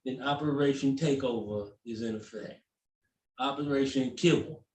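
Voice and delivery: low, hushed conspiratorial boss voice; brief the troops like a scheming ringleader; plotting dog boss. Pretend.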